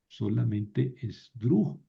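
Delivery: ordinary talk, nothing marked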